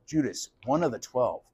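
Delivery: murmured realization; hushed dawning. Acted. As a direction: say it quietly to yourself. Letting the word sink in.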